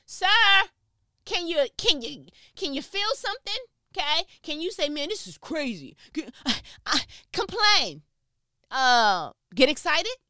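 high, loud exasperated pleading; hammed-up frustrated outburst in bursts; mock angry. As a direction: nag an unresponsive husband at full volume. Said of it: "I get a little angry sometimes".

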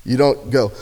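usual speaking voice

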